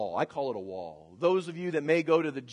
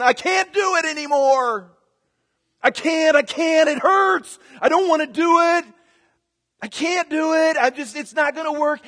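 strained, higher and louder pleading; exhausted pleading; 10 semitones higher, 8 dB louder than usual. Retold or imitated. Runner on the treadmill begging to stop, strained whine. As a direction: beg to stop like spent lungs.

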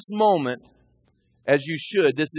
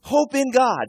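urging his soul; 8 semitones higher, slower than usual. Acted. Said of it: "See, he's talking to himself".

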